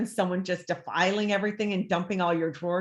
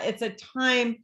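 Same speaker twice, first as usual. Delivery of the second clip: ordinary talk, nothing marked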